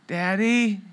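put-on soft, sleepy little call of a child from down the hall; daughter calling softly. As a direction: call his name quietly, like a half-asleep girl. Daughter calling from her room.

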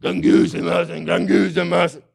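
high, loud mocking mumble of the grumbling word; mock grumbling sound. Shown as a demonstration. Preacher mimicking the grumble word for his listeners, loud and mocking. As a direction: imitate grumbling noises with the Greek word. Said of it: "It sounds like".

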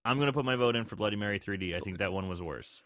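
ordinary talk

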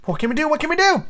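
high, helpless storybook cry; acted high-pitched panic; panicked boys. A narrator's reading. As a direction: fret it in a high voice, rising to alarm. Boys panicking as wolves chase.

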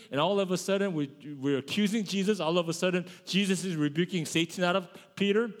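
ordinary talk, nothing marked

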